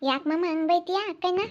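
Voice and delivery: high, soft character voice; questioning younger relative. Acted. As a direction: ask the uncle in a small, high voice. Another character questions the scolding uncle. (ಎತ್ತರದ, ಮೆಲು ಪ್ರಶ್ನೆಯ ದನಿ)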